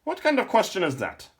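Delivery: put-on high, loud old man's voice; an indignant old lore master; sputtering old man.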